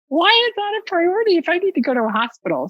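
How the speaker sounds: high, fast, exasperated question; exasperated disbelief. Retold or imitated. Sick caller arguing with the hotline lady, high and exasperated. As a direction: fire it back in disbelief. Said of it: "I'm like".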